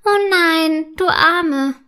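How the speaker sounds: put-on high, drawn-out childlike pity; piping, compassionate mermaid child; sympathetic. A narrator's reading. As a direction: commiserate with a new friend. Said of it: "sagt Rosenflosse mitfühlend".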